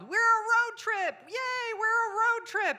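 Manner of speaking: put-on very high, slow squeaky kid voice; excited toddler yell. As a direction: cheer like a thrilled three-year-old.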